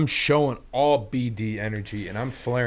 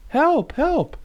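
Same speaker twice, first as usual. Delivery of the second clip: high cry for help. Acted. Victim calling for help.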